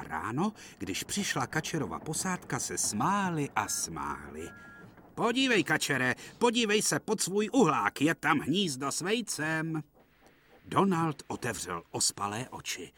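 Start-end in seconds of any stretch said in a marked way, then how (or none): 5.1-9.8 much higher, gleeful teasing call; excited teasing. Acted. Duck's crew teasing Donald, high and gleeful. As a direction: crow over the joke.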